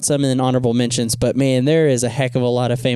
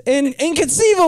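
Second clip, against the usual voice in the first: Vizzini misquote; 13 semitones higher than usual. Retold or imitated. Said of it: "not despicable".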